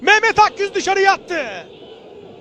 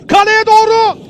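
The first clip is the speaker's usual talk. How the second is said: excited commentary; 4 semitones higher, 8 dB louder than usual. Real, not acted. Shot towards goal.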